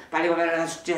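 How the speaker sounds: nagging parent. Retold or imitated. Parent hurrying kids to homework, nagging.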